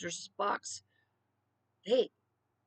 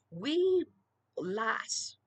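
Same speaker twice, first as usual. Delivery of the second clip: put-on playful Scottish accent; 2 semitones higher, 4 dB louder than usual. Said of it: "and I'm not even Scott-ish".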